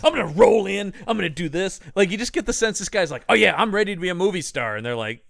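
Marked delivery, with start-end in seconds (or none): 0.0-1.8 loud, high-pitched gung-ho mimicry; mock eagerness, pitched up; eager action star. 3.3-4.8 quick, high, loud mock enthusiasm; imitating an eager star, pitched up; eager wannabe star. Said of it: "Cena's so eager and ready".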